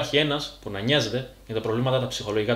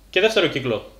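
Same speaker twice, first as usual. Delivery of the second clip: usual speaking voice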